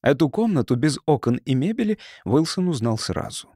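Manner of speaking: ordinary delivery, nothing marked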